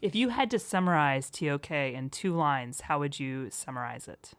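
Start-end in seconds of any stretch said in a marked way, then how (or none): none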